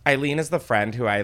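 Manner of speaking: normal talking voice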